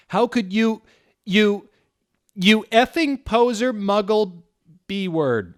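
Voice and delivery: high, loud, slow hammed-up yell; shouting. A narrator's reading. Draco screaming insults at Ebony, high and loud.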